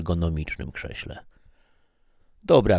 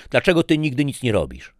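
higher, louder and fast accusation; angry outburst; 9 semitones higher, 7 dB louder, faster than usual. Acted. Wife slamming plate and accusing husband, angry outburst. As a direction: snap it at him in frustration.

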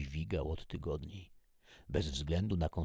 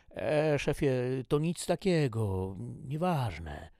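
high, louder, brushing it off; evasive subordinate; 9 semitones higher, 7 dB louder than usual. Acted. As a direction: dodge the boss's question. Subordinate evades chief's concern. (wymijająco, głosem podwładnego)

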